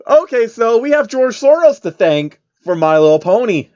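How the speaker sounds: amused and incredulous; laughing disbelief. Real, not acted. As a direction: say it while barely recovering from laughing. Reader still laughing.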